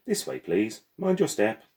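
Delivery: cheerful porter guiding guests up the gangplank; mechanical porter voice. Acted. Porter leads them aboard.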